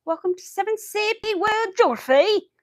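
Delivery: high, loud imitation of a British teacher with a thick UK accent; British teacher impression. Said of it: "he had the thickest accent".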